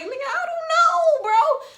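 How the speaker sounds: high, drawn-out exasperated protest; loud, high-pitched clueless wail. Retold or imitated. Teller answering the man's question, exasperated.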